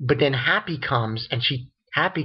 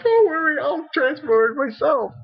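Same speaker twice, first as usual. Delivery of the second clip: high, louder mock bravado; boastful gold leader; 13 semitones higher, 3 dB louder than usual. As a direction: puff up like a silly show-off. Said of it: "So he's like".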